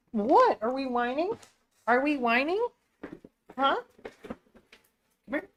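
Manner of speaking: high, cooing questions to the dogs. Real, not acted. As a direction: coo at a whining puppy. Baby talk to whining dogs.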